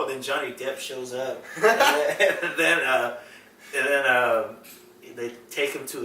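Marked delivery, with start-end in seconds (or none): none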